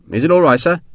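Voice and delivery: a touch of worry in the asking; anxious. A narrator's reading. Sergeant asking the general if it's acceptable, mildly anxious. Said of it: "he'd asked Wellesley anxiously".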